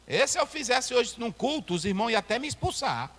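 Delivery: ordinary talk, nothing marked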